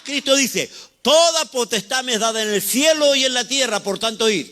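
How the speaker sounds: rising preaching fervor. Real, not acted.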